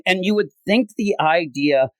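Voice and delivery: ordinary delivery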